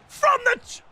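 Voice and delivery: squeaky high mock commentator; sudden squeaky announcer. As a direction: pitch it way up, cartoonish. High squeaky restart, far timbre.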